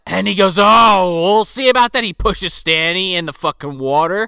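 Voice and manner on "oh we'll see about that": louder, high, hammed-up indignation; mock indignant threat. Ollie about to push Stanny in, mock indignant. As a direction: huff it loudly in mock anger. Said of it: "Ollie's pissed".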